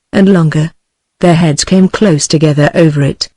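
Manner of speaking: ordinary delivery, nothing marked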